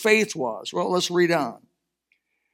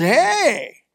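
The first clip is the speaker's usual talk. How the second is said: eager call; 10 semitones higher, 5 dB louder than usual. Acted. Peter calling to Jesus.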